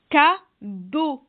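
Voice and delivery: "Cadeau" split ca-deau, loud first syllable; découpage en syllabes. Shown as a demonstration. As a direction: sound out "Cadeau" in two separated syllables. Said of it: "combien de syllabes dans cadeau". (« Cadeau » en deux syllabes bien séparées)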